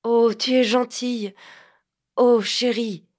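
eager, pressing endearments; eager tenderness. Acted. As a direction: hurry to win her back with sweetness. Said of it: "s'empressa de la voix".

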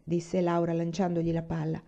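plain reading voice

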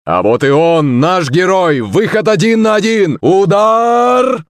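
climbing commentator shout to a high peak; football commentator. Acted. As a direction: build it up and scream the goal. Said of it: "снова прокричал Кузя".

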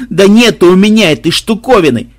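loud, high desperate shout; near-tears yell. Acted. Tortured thief yells his denial, tearful shout. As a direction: wail it, almost crying. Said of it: "чуть не плача заорал пытуемый".

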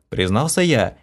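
ordinary delivery, nothing marked

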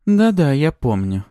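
high, drawn-out brush-off; dismissive. A narrator's reading. Woman brushing off Maxim's reminder, high and dismissive. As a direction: wave it away slowly in a high woman's voice. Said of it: "отмахнулась женщина".

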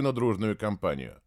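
usual speaking voice

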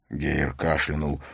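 usual speaking voice

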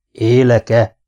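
usual speaking voice

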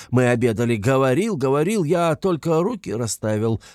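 lively, lamenting chatter with swooping pitch; fussing, voluble investigator. Acted. Investigator recounting Razumikhin's visit to Raskolnikov, fussing and voluble. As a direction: gush with exasperated concern.

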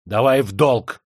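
loud angry demand; suddenly furious. A narrator's reading. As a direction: burst out with a fierce demand. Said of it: "рассвирепел вдруг гость".

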